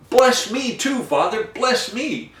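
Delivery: high, pleading cry of a wronged son; a mild, high-pitched imitation of a bitter plea. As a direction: beg for the blessing, voice lifted high. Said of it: "burst out with a loud and bitter cry".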